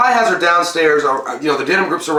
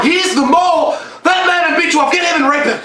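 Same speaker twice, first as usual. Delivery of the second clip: high, laughing shout; mock yell; 12 semitones higher than usual. Acted crowd reaction, yelling.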